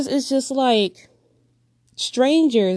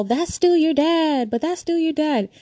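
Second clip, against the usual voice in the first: mock preachy advice; 7 semitones higher than usual. Mocking phrase people tell her.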